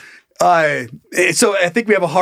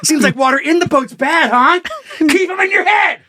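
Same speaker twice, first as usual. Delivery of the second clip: mock shouting; 9 semitones higher than usual. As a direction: scold a crying rafter at full volume. Mock yelling at crying rafter.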